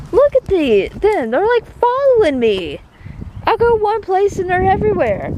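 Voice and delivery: delighted surprise at following cats; excited. Real, not acted.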